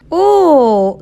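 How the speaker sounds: a cracking, choked oh; voice breaking. Acted. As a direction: let the voice crack on it. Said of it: "con la voz quebrada".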